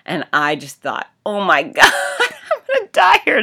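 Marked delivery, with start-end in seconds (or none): 1.2-3.4 high squeaky laughing cry of mock despair; mock dramatic wail through laughter. Inner thought after hitting ankle.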